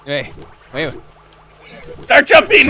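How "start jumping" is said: excited shout. Real, not acted. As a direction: shout it out, urgent. Urging partner in game.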